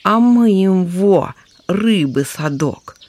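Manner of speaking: торжествующе; triumphant. Acted. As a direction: gloat over the catch. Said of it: "торжествовал второй".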